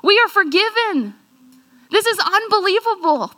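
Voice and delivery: elated cry of being forgiven; joyful disbelief. Acted. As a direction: burst out as if just healed. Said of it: "jumping up and down and crying and laughing".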